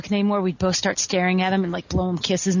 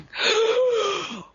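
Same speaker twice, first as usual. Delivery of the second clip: sharp shocked high gasp; retold gasp; 13 semitones higher than usual. Recalling the moment she saw Steamboat, a gasp. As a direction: gasp in horror at what you did. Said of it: "And I was like".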